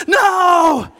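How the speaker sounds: high, loud drawn-out refusal; acted defiant scream. Son refusing mom's spanking, defiant scream. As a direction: belt it out in childish defiance.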